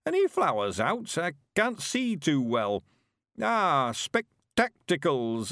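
put-on high, slow creaky old man voice; an eager old countryman, chuffed; old Mister Weevil.

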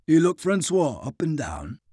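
ordinary delivery, nothing marked

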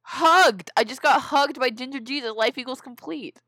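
high, loud exclaiming; excited exclamation. Acted. Reader redoes the status update after correction, excited exclamation. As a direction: shout it out high, hammed-up.

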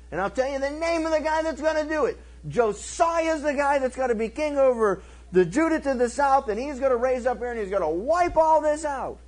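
high, insistent prophecy; prophet's warning. Retold or imitated. Prophet naming who will wipe out the altars, insistent warning. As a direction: pitch it up and press on without letting go.

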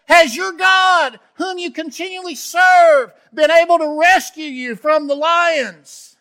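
high, loud pleading shout; loud, high, anguished plea. Acted. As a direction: call down into the den, desperate for an answer. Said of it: "he cried out in anguish to Daniel".

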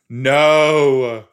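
loud, drawn-out "no"; shocked disbelief. Real, not acted. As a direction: refuse to believe what just happened. Disbelief at a plot twist.

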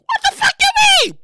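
high, loud incredulous yell; outraged shout. Pretend. Host self shouting at writer alter ego. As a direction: shriek in disbelief. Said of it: "the two alter egos".

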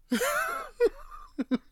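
a sudden high laugh; high squealing laugh. Real, not acted. Laughing hard at own point.

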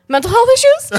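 high, loud mock concern; mock concerned manager. Manager asking about illness, mock concerned. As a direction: ask it like a worried boss. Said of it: "he's so concerned".

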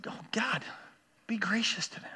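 soft, broken-up plea with pauses; hushed prayer. Retold or imitated. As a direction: drop to a quiet, private prayer. Murmured prayer for hurting people.

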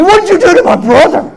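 high, loud confrontational yell; imitating an outraged brother; shouted demand. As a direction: yell the question as an accusation. Brother phones demanding an explanation.

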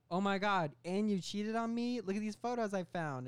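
high, loud mock accusation; Gillian Jacobs. Girlfriend confronting him about cheating, mock outrage. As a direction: play a hammed-up wronged girlfriend. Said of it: "Gillian Jacobs is like".

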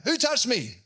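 yelled out. Shown as a demonstration. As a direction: call it out across a crowd, demanding. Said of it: "I don't reckon Jesus yelled out".

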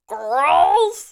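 put-on high, loud stretched girls; a hint of the Despicable Me villain calling girls. Quoting the Despicable Me line girls, high, loud and stretched. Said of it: "from Despicable Me when he says".

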